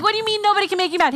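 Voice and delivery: a quick, high, disbelieving retort; incredulous. Retold or imitated. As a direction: fire back the question fast, sounding stunned. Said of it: "I'm like".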